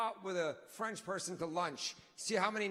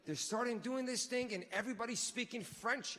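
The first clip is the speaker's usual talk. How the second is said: ordinary talk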